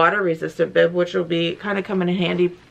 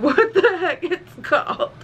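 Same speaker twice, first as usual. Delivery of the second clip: laughing; 10 semitones higher than usual. Real, not acted. Laughs at forgotten word.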